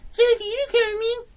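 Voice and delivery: very high squeaky mocking voice; Cartman. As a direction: parrot it back in a mocking falsetto. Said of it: "It sounds like when he's mocking someone".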